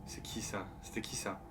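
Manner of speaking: hushed unvoiced whisper. Real, not acted. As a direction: breathe the question, barely voiced. Confused by a stranger on screen.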